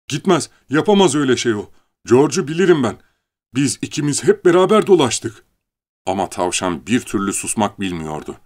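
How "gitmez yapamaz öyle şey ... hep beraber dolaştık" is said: higher, louder outcry; crying out. Acted. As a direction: cry out in protest. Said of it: "Leni haykırdı". (yüksek sesle haykırarak)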